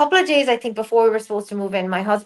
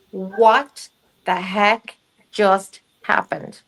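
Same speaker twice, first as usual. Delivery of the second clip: slow, word-by-word astonishment; drawn-out retold bewilderment; stunned disbelief; much slower than usual. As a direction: space out each word as if stunned. Retold disbelief at chaos in house.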